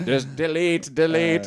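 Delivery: high, loud robotic chant; Cyberman voice. Retold or imitated. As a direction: intone it like a cyborg. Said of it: "like the old cyborgs".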